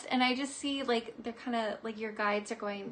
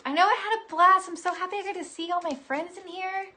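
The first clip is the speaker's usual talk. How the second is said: higher, louder glad gush; happy gush; 7 semitones higher, 6 dB louder than usual. Real, not acted. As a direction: beam at friends. Happy sign-off.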